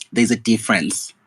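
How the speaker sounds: ordinary delivery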